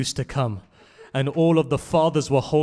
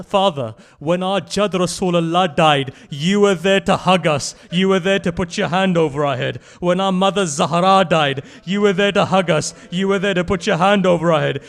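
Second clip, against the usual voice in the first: raised, loud plea of a grieving son; pained lament; 5 semitones higher, 9 dB louder than usual. Acted. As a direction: plead in pain with a dying father. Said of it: "says something which was painful".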